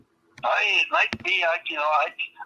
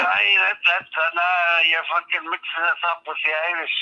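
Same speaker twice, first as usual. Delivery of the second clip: broad mock Scottish brogue throughout; put-on Scottish accent. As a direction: play a hammed-up Scotsman, aye. Fake Scot on a prank call.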